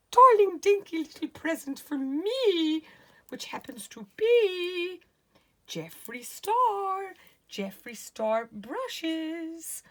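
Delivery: very high, loud gushing squeal; excited squeal. Real, not acted. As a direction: squeal with delight over the gift. Gushing over present brushes.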